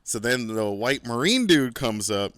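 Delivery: normal talking voice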